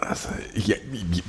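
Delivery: breathy, barely voiced start; hesitant whisper. Real, not acted. Hesitant start to personal question.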